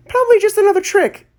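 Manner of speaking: put-on high, fast dismissive old doctor; old woman voice. A narrator's reading. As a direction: brush it off like a busy old lady.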